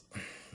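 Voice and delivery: soft breathy exhale; composing sigh. Acted. Assassin deciding she is no threat, soft sigh. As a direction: let the tension out.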